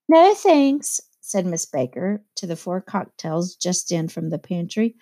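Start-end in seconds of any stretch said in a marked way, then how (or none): 0.1-1.0 crisp refusal. A narrator's reading. Miss Baker refusing cocktails.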